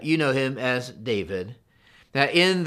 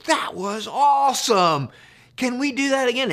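put-on thrilled kid; 9 semitones higher than usual. Daughter after the ride asking dad again, high delighted. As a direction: gush like an excited little girl. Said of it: "she said".

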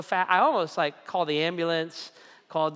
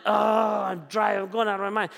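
frantic; 3 semitones higher, 3 dB louder than usual. Pretend. Acting out anxious torment.

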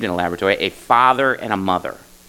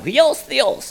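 Huios theos in a playful, much higher Greek accent, the h breathed into hwi; mock Greek accent. Shown as a demonstration. Pastor shows off the Greek phrase to his congregation in a hammed-up Greek accent. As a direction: ham up Huios theos like a Greek speaker, pitched way up. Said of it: "I wanna say it with a Greek accent".

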